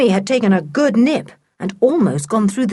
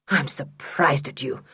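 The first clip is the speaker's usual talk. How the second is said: cold whisper; 4 semitones lower, 7 dB quieter than usual. Acted. Stepfather rebukes the children.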